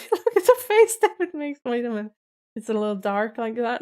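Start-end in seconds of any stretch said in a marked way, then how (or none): 0.1-1.6 high-pitched, giggly fangirl squeal; laughing squeal. Real, not acted. Fan pointing out David's face on screen, laughing squeal. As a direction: squeal it through a laugh, much higher.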